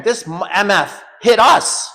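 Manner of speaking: loud, high, slow outraged curse; retold angry swearing. Swearing at the cops, slow angry accusation. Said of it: "I was swearing".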